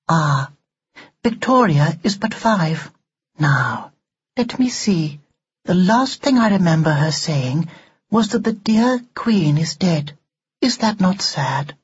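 high, measured doll voice; prim talking doll. Acted. Doll remembering Victoria's last words to her, primly. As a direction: recall it formally in a high voice.

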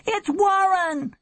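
very high, drawn-out cry; slow, high-pitched excited shout; yelling. A narrator's reading. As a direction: yell your name eagerly down the phone. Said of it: "he yelled".

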